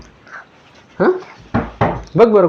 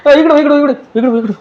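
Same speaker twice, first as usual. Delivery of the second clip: playful alarmed calling; 10 semitones higher, faster than usual. Pretend. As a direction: keep calling her back, hurried. Mother keeps calling Pari.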